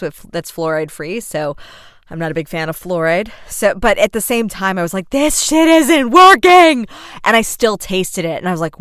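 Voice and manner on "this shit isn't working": high, loud, slow exasperated yell; frustrated outburst. Retold or imitated. Mom brushing her teeth, yelling in disgust. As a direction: vent it at the useless toothbrush.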